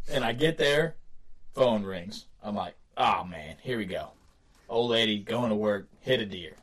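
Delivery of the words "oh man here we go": low, soft, weary aside; downbeat, resigned mutter to himself; resigned sigh of words. Retold or imitated. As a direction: grumble it low, here comes trouble. Resigned reaction to phone ringing.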